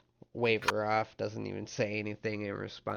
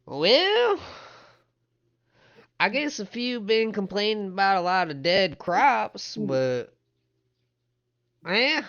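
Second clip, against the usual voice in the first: high, loud, hesitant character voice; older townsman, hedging; 10 semitones higher, 7 dB louder than usual. Acted. Older man answers about the recent dead, high and hedging. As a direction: stretch out a high doubtful "well".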